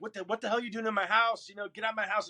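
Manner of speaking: high-pitched retold freak-out; mock outrage, not shouted. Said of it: "and I freak out".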